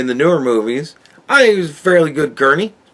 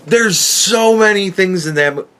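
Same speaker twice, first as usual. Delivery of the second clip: ordinary delivery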